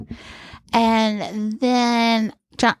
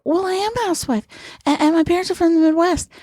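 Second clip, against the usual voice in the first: higher-pitched, rapid housewife character; Midwestern housewife; 7 semitones higher, much faster than usual. Retold or imitated. Comic answering the producer's challenge, high quick housewife voice. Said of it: "be a Midwestern housewife".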